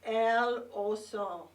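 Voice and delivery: El Oso said slowly and clearly for the class to repeat; slow model phrase. Shown as a demonstration. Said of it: "Can you say that with me?".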